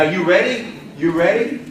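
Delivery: usual speaking voice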